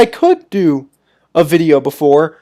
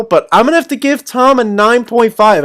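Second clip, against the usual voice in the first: normal talking voice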